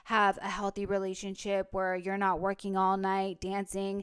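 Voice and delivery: ordinary talk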